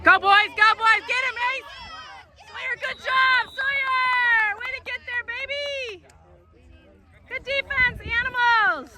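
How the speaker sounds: high shouted cheers; yelling from the sidelines. Real, not acted. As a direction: call out praise to the team.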